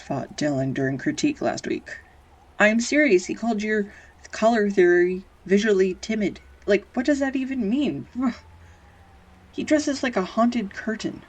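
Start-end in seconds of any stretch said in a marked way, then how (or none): 2.6-11.2 mock outrage. Real, not acted. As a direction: huff in playful defense of your partner. Indignant on partner's behalf.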